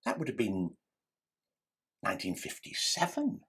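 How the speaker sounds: ordinary talk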